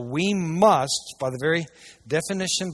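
ordinary talk, nothing marked

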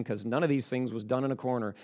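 ordinary delivery

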